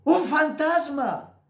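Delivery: high, drawn-out mock cry of alarm; imitated frightened shout. Disciples seeing a figure walk on the sea, imitated frightened shout. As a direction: cry out loud, playing terrified men who see a ghost. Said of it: "comenzaron a gritar".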